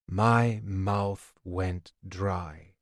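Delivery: usual speaking voice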